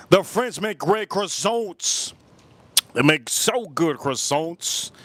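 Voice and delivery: slow, drawn-out praise; slow savouring. Pretend. Streamer praises French croissants, slow savoured delivery. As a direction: drag each word out lovingly.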